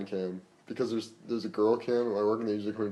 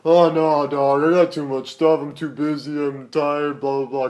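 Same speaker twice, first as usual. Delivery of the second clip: loud, higher-pitched imitation of whining excuses; mock excuse-making; 4 semitones higher, 10 dB louder, slower than usual. Friend imitating co-host turning down an invite, mock complaining. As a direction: imitate a friend begging off, loudly. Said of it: "I thought you would have been like".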